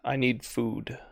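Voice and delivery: hushed, lightly voiced unseen creature; voice in the head. Game master as a voice in the player's head, quiet. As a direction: speak softly, as if inside their head. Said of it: "you hear this voice in your head".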